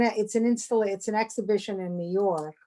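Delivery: usual speaking voice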